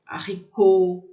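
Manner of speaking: loud "Haricot", stressed on "cot"; strong final syllable. Shown as a demonstration. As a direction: hit the last syllable of French "Haricot". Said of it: "é forte no final".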